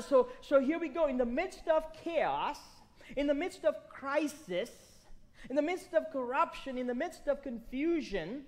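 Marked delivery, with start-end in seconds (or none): none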